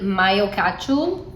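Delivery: mayo as "my-o", ketchup as "catchoo"; drawn-out "my-o catchoo" for mayo ketchup; odd pronunciation. Real, not acted. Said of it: "I don't know why I said it like that".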